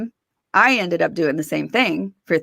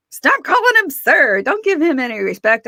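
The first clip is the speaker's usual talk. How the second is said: much higher, louder insistent fiance; fiance's protest; 7 semitones higher, 4 dB louder than usual. Retold or imitated. Fiance objecting to politeness, raised and high. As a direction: push back hard, mocking the respect. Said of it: "he's like".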